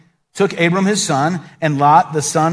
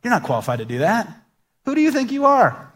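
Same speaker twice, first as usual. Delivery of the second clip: mock self-doubt, jumping much higher on the question; doubting inner voice; 6 semitones higher than usual. Voice in your head doubting your calling, mock challenge. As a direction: challenge them like a nagging inner critic. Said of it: "that voice that goes off in your head".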